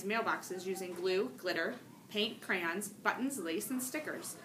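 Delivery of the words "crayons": ordinary delivery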